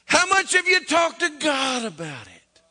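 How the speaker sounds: a loud preacher's rebuke; preaching challenge. Real, not acted. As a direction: call them out from the pulpit. Preacher confronting the congregation.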